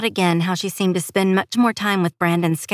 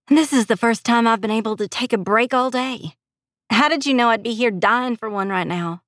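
higher-pitched voice of a grateful nurse; tired, grateful heroine; 5 semitones higher than usual. Acted. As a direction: thank your friend in a lifted voice. Grateful heroine speaks to friend.

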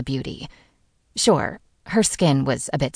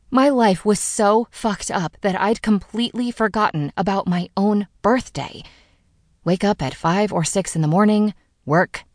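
usual speaking voice

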